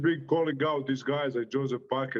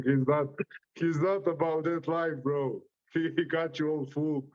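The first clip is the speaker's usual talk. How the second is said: usual speaking voice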